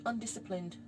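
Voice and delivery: ordinary delivery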